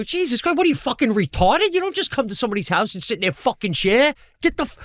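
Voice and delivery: high-pitched mock yell; outraged rant, voice pushed up high; retold yelling. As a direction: act out losing your temper, pitch soaring, volume held back. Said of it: "I'm like, I'm yelling".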